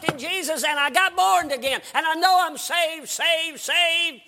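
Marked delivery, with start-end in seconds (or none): 1.9-4.2 high, loud jubilant preaching. Real, not acted. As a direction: ring it out in triumph. Preacher's climax of testimony.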